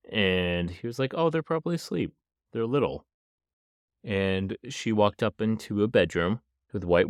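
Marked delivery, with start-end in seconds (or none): 1.1-3.1 higher-pitched, lightly acted reassurance; imitated casual excuse. Dad explaining why the puppies are silent, casually imitated. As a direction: voice his breezy excuse up high.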